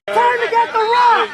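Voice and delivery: slow, loud rallying yell; rally the team with a roar; hype shout. Real, not acted. Coach hyping the defense.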